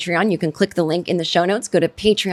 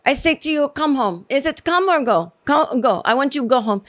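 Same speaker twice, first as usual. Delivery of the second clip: mock Spanish accent, higher pitch; struggling second-language English; 5 semitones higher than usual. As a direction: imitate a Spanish speaker fumbling with English. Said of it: "she's struggling to get the verbiage right".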